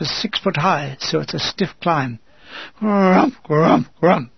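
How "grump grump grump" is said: vocalised shell bursts, rolled and thudding; short, booming explosion noises; mortar blast imitation. A narrator's reading. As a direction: imitate mortars landing one after another. Imitates mortar bombs landing.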